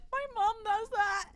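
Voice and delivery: high, loud, laughing exclamation; delighted amused outburst; laughing recognition. Real, not acted. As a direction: burst out through real laughter.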